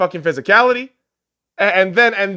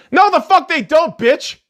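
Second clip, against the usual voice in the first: yelling back, higher and louder; angry shout; 10 semitones higher, 5 dB louder than usual. Real, not acted. Ranting vlogger yelling back at LeBron, angry shout. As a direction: snap back at the quote with a loud yell. Angry retort to mocked quote.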